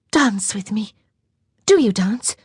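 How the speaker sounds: a lightly voiced young lady, wistful; young French girl. A narrator's reading. Girl's voice held.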